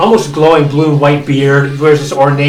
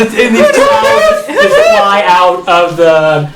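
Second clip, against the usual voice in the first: ordinary delivery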